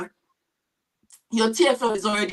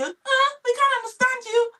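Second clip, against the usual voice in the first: high squeaky mocking voice of someone who can't understand you; mocking mimic; 4 dB louder than usual. Teacher imitates people who make fun of her accent, in a high mocking voice. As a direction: mimic the jeering listener, pitched way up. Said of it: "make fun of you and say".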